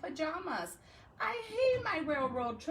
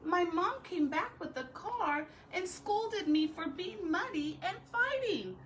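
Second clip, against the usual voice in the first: building indignation; 6 semitones higher, 3 dB louder than usual. Acted. Escalating list of woes.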